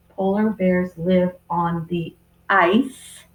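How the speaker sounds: slow, even reread; teacher rereading the sentence to class. Shown as a demonstration. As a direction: read back the sentence slowly. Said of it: "trying to sound out my sounds".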